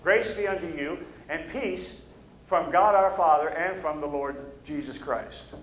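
ordinary talk

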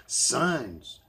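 loud, high, falling "signs"; emphatic shout. Acted. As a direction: hammer the word "signs" home. Repeating the hated word, louder.

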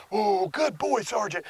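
high, affectionate praise for the dog; loving reward voice. Shown as a demonstration. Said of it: "Same vocalization".

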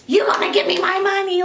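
high-pitched mock outrage; hammed-up agitated demand; retold going off. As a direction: imitate someone suddenly demanding payment. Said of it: "he started going off".

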